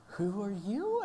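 high, loud mock bafflement; comically stunned by a stranger; baffled. Retelling baffled reply to [name].

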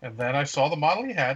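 usual speaking voice

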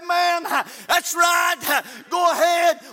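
loud amen-corner shouts; punchy shouted agreement; congregation cheering on preacher. Acted. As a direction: holler encouragement from the pews. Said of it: "It ought to be somebody say".

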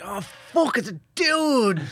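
high, slow mock-horrified realisation; mock shock. Friend acting out the shock of realising, high and slow. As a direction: act out the horror of realising.

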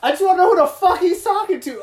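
fast high shout at the bartender; heated yell. Retold or imitated. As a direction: rattle it off fired up. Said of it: "I was like".

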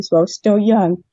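ordinary talk

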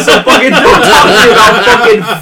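loud laughing comeback; laughing retort. Real, not acted. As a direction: laugh then shout the comeback. Laughing retort about filler.

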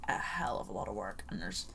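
soft whispered aside. Real, not acted. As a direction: drop to a whisper. Aside about hard pattern.

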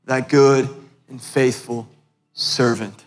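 low, hushed, with long reverent pauses; solemn tender welcome. Retold or imitated. Heaven welcoming the humble intercessor at the gates, solemn and tender.

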